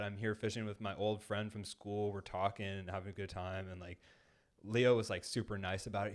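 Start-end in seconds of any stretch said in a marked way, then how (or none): none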